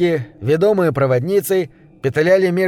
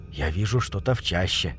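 low, soft, wary voice; hushed alert; 8 semitones lower, 8 dB quieter than usual. A narrator's reading. Rider warning companions of something in the thicket, hushed. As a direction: drop low and keep it hushed.

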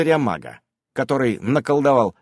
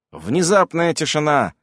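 plain reading voice

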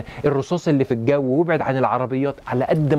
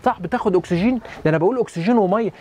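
usual speaking voice

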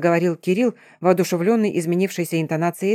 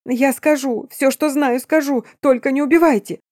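piercing terrified shriek; 7 semitones higher than usual. A narrator's reading. Man begging the vampire, high piercing terror. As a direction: shriek for your life. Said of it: "пронзительно верещал перепуганный насмерть мужчина".

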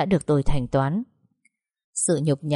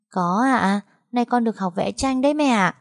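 higher, lightly voiced child; cheerful little girl; 6 semitones higher than usual. Daughter tells mother about drawing class, childlike. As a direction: answer brightly like a small kid.

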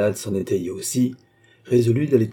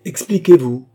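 sharp, much louder demand; impatient command; 5 semitones higher, 9 dB louder than usual. A narrator's reading. Queen, losing patience with the doctor, loud impatient order. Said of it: "fit la reine impatientée".

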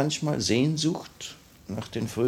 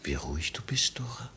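whispered, much softer; 8 dB quieter than usual. Acted. Annenkov whispering to Dora, hushed. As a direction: breathe it out in awe.

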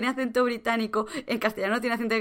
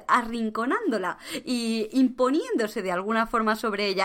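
normal talking voice

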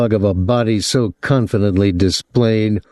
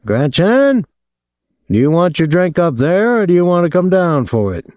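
raised, higher voice calling to an upstairs room; lightly raised call upstairs; calling up the stairs; 9 semitones higher than usual. A narrator's reading. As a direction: call up to her, pitched high. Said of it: "He called up the stairs".

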